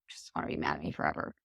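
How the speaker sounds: low, soft and fast, trailing off; mumbled trailing apology. Retold or imitated. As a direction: mumble it away under the breath.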